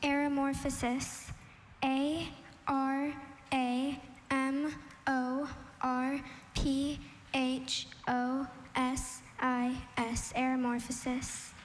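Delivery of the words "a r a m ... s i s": slow, one letter at a time; spelling out. Real, not acted. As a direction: spell it with a clear pause after each letter. Contestant spelling out the word.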